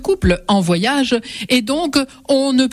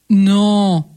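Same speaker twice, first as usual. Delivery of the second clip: ordinary delivery, nothing marked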